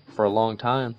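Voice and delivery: usual speaking voice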